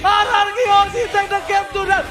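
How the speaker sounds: high, loud, slow victory shout; fighting game announcer. Real, not acted. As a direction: drag out the game-winning call. Caster calls the game end.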